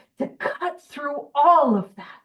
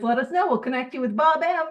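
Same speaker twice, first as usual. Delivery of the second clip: ordinary talk, nothing marked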